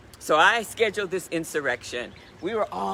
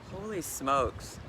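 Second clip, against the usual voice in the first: stunned mutter; 2 semitones lower, 6 dB quieter, slower than usual. Retold or imitated. Sitting alone, stunned.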